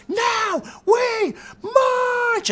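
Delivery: very high, drawn-out rallying cry; retold scream. Woman rallying Occupy protesters to march, imitated scream. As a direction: stretch each word into an imitated shriek. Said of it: "and then she screams".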